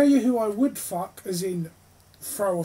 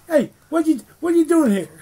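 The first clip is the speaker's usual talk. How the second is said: loud, high mock Jersey tough; mock New Jersey; 8 semitones higher, 9 dB louder than usual. Friend joining the Meryl Streep bit, loud mock Jersey voice. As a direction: bark it like a hammed-up Jersey guy.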